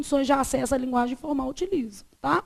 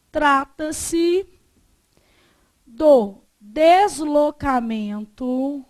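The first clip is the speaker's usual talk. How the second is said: dictating. Real, not acted. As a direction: dictate it piece by piece with long pauses. Teacher dictating notes to class.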